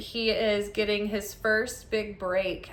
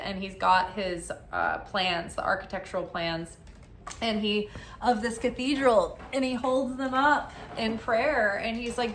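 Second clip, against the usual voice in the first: ordinary talk, nothing marked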